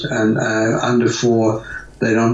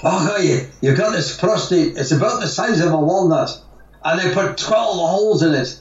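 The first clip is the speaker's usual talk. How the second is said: put-on higher-pitched, amateur Scottish accent; Scottish accent impression; 7 semitones higher than usual. Retelling the Scotsman's biopsy complaint to the host in an imitated Scottish accent. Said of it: "I don't do a Scottish, Scottish accent very well".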